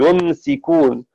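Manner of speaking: يمسكون said slowly with a silent mim and a light sin; slow يمسكون, the mim resting on sukun; still mim. Shown as a demonstration. Teacher showing the mim carrying sukun, slow and careful. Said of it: "تسكن الميم".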